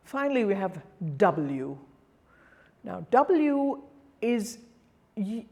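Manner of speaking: ordinary talk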